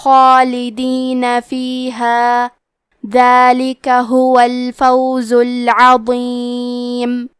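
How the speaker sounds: slow, drawn-out melodic tilawat; Quran recitation. Acted. Tajweed teacher recites the verses for students, slow melodic chant.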